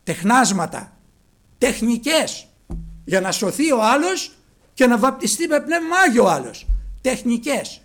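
loud, high scornful condemnation; preaching at full force. Acted. Preacher condemning modern tricks, loud scornful preaching. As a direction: spit out the word with scorn.

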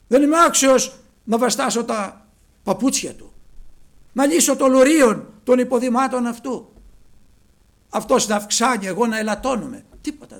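high and loud, earnest; humble loud proclamation. Acted. John speaking of Christ to the crowd, loud earnest proclamation. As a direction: declare your unworthiness aloud. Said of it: "δεν λέει".

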